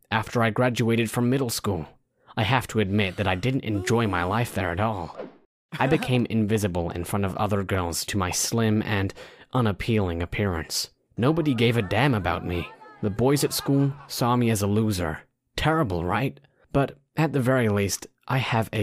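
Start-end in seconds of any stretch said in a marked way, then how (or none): none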